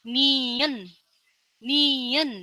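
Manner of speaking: Nien said clearly, falling then rising; letter name demo. Shown as a demonstration. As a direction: model Nien twice for learners. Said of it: "grup N".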